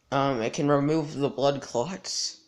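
ordinary talk, nothing marked

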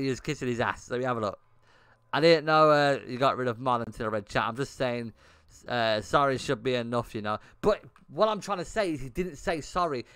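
ordinary delivery

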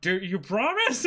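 half-laughing imitation of an eager hero; Luffy laughing. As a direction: giggle the question as Luffy. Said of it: "Luffy's like".

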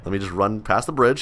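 normal talking voice

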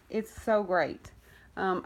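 ordinary delivery

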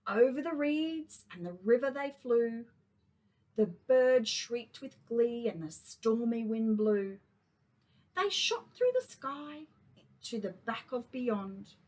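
storybook narration; reading to children. A narrator's reading. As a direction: read it to little kids. Reads a picture book to kindy.

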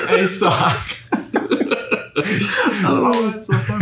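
giggling helplessly; can't stop laughing. Real, not acted. Giggle fit over abbreviation.

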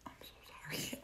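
very soft, voiceless whisper; whispered apology. Retold or imitated. Woman apologizes to people nearby behind his back, whispering. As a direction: mouth it under your breath, apologetically.

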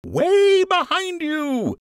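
loud, high, drawn-out teasing call; shout. Acted. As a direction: yell it back from a distance. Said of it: "he shouted".

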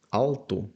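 Alto said slowly, final O sounded as U; Portuguese alto. Shown as a demonstration. Teacher repeating Portuguese word, Alto ending in U. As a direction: finish Alto with U. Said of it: "В кінці читається як У".

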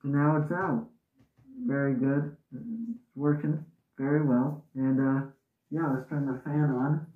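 muffled behind mask; speaking through gas mask. Real, not acted. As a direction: voice through respirator. Seen 5: full-face gas mask with filter worn throughout labelled speech.

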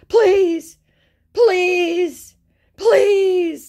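very high, loud hammed-up wails; mock begging. Scammer begging after being told no thanks, mock begging. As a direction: wail each word as a separate exaggerated plea.

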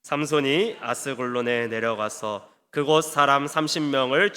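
slow, low-pitched congregational recitation; scripture reading. Real, not acted. Preacher leading the congregation through verse nineteen, measured scripture reading. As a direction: read the verse out slowly and evenly, pitched low.

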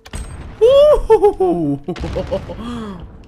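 loud high laugh; big burst of laughter. Real, not acted. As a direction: crack up hard. Laughing at a teammate's play.

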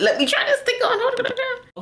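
high-pitched laugh through the words. Real, not acted. Vlogger laughing as she starts the try-on, high giggly voice.

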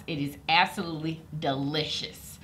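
ordinary talk, nothing marked

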